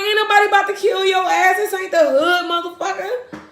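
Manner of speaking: high, loud, fast retort to a scared man; retold retort. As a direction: fire it back, half laughing at him. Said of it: "I'm like".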